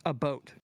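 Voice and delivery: "a boat" with an oh vowel; "a boat" for about. Shown as a demonstration. Explaining a Canadian accent, "a boat" with oh. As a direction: say "a boat", rhyming with note. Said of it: "the accent that more or less says".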